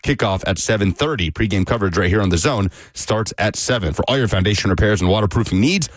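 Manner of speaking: radio sports update read; news read. Acted.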